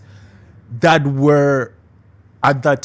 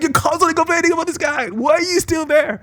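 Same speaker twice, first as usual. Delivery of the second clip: exasperated. Retold or imitated. Quoting herself to complaining friend.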